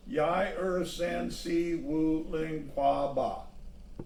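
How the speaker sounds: slow, carefully sounded Chinese numbers; gently counting to eight in Chinese. A narrator's reading. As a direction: count it out slowly, each syllable its own step. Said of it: "his voice went up and down softly with each word".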